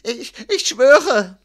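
high, loud, halting plea; whimpering. A narrator's reading. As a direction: stammer your oath in terror. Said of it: "wimmerte Pomero". (wimmernd, stockend und hoch)

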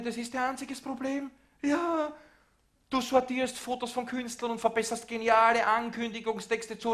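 loud, high indignant tirade; angry rant. Acted. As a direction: tear into him, voice up high. Angry rant at someone in office.

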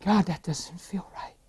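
hushed, whispery inner voice; inward prayer. Retold or imitated. Man praying to God in his heart, whispering. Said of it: "in my heart, Randy, I said".

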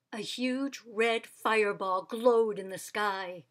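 plain reading voice

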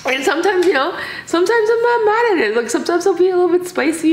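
high, fast giggling voice; giggly. Real, not acted. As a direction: giggle through a silly confession. Giggly high voice, joking confession.

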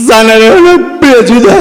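slow, tearful mock blubbering; hammed-up crying. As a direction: talk through fake tears. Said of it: "તો એ રોતા રોતા બોલ્યો". (રોતાં રોતાં નાટકીય બોલવું)

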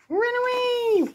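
squeaky high bird cry; cartoon bird voice. Bird voice in story.

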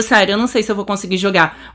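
normal talking voice

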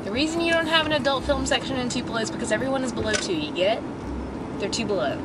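normal talking voice

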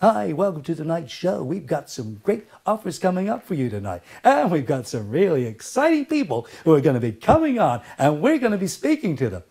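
gushing mock presenter with swooping pitch; insincere TV host. Host welcoming viewers to tonight's show, put-on insincere enthusiasm. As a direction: parody a falsely excited show opening. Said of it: "That's so insincere".

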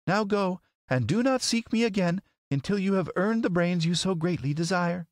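high, imperious lady. A narrator's reading. Lady wizard sending the scarecrow away, imperious. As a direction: dismiss him firmly in a high voice.

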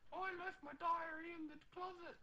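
mock Anne Frank making excuses in a squeaky voice. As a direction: play her as a prissy high-pitched girl. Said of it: "She's like".

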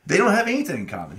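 retold protest, high and fast; exasperated protest. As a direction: rush it out in mock exasperation. Retold argument with God, protesting.

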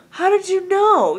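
high, loud mock surprise; mock surprised question. Teen mocking a kid's reply to being called emo, high mock surprise.

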